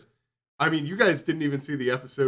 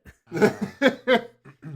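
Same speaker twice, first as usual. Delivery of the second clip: laughing; 10 semitones higher, 6 dB louder than usual. Real, not acted. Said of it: "now do it in a Boston accent".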